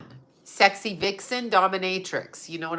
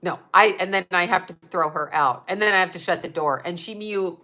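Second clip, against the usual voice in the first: usual speaking voice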